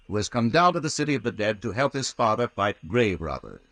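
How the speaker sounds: ordinary talk, nothing marked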